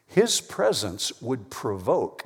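normal talking voice

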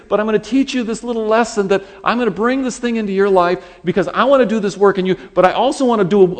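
high, loud, driving divine speech; God, insistent and mighty. Acted. God teaches a stressed man a lesson, loud insistence. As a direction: build to a forceful, sweeping declaration.

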